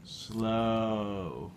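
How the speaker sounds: long, soft, falling vowel; slow, softening reminder; drawn-out coaxing. Real, not acted. Parent coaxing child to eat slowly.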